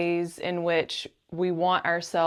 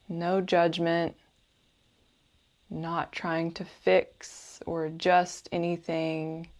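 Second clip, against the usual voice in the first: guided meditation; 4 dB quieter than usual. Acted. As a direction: gently guide the listener into calm, unhurried and soothing.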